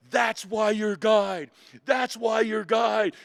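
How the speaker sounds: slow, high, loud instructor shout; roaring approval. Retold or imitated. Drill instructors pick up the recruit, roaring approval. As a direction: hammer out each word as loud praise.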